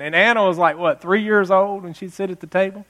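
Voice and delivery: ordinary talk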